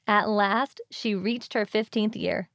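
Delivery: ordinary delivery, nothing marked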